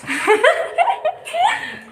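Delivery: high, real burst of laughter. Real, not acted. Daughter laughs at mom's teasing reply, a high burst of laughter.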